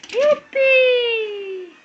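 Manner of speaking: high, loud drawn-out whoop; long playful whoop; gleeful cheer. Real, not acted. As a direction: cheer as the toy flies. Throwing the toy for Fred.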